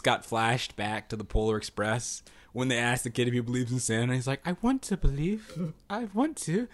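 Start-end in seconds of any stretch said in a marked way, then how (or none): 4.5-6.7 put-on a light, higher-pitched British accent, a touch slower; a quick, hammy British-accented kid, a bit higher; lightly mock British accent, wistful and high. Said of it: "I don't know why I did that accent".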